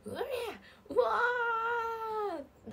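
high, drawn-out mock battle shout; fired-up yell. Streamer jokes about yelling backstage, playful yell. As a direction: let out a hammed-up fighting roar. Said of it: "すんごい気合入ってる声".

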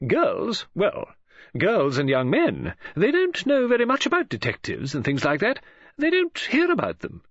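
high-pitched, emphatic chatterer; opinionated woman friend. Acted. Woman friend tells the detective the young don't know him, insistent.

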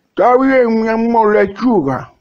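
sweetly obey the wife; lightly voiced old husband. Viejo contesta.

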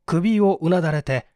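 ordinary delivery

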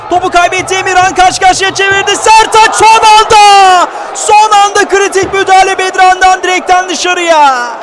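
high, loud play-by-play near miss; excited commentator shout. Real, not acted. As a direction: yell as the chance nearly goes in. Near miss, ball off the post.

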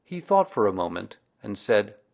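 plain reading voice

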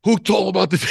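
retold indignant demand; indignant question. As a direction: demand to know who told. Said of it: "I go".

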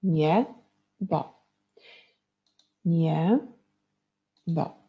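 "Nie, bo" cut into two syllables with a pause between; teacher dividing "Niebo" into syllables; split into syllables. Shown as a demonstration. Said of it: "Dzielimy na sylaby".

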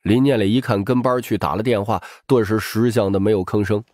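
plain reading voice

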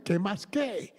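"¿qué más qué?" echoed back, mildly confused; "¿qué más qué?" as a lightly voiced bewildered reply; puzzled echo. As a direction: repeat "¿qué más qué?" as a baffled question. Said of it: "yo me quedé así porque".

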